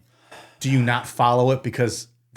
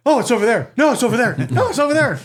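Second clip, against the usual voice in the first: each line higher, mock excited; high mock panic; 11 semitones higher, 4 dB louder, faster than usual. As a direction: squeal it out rising each time. Mock searchers chasing echoes.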